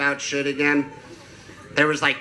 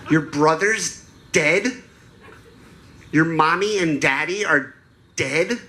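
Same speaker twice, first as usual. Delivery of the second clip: imitated four-year-old, high and incredulous; drawn-out questions swooping upward like a small child; mock-shocked helium kid on a toy phone; little girl voice; 4 semitones higher, slower than usual. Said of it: "that adorable little four-year-old girl helium balloon voice".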